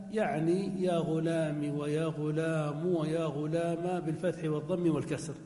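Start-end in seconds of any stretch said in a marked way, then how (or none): 0.1-4.0 غلام said ghulāma, then ghulāmu, then ghulāmi, each ending clear; slow, run غلام through fatha, damma and kasra endings; three endings. Shown as a demonstration. Grammar teacher spelling out all three endings of غلام. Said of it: "بالثلاث".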